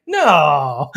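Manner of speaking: long, falling mock denial; imitated sarcastic group reply. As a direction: stretch it out with feigned innocence. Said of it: "are you being sarcastic".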